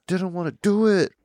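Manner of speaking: put-on high whiny kid complaint; a lightly voiced whining kid; childish whine. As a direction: whine like a sulky child to mom. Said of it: "getting really angry at them".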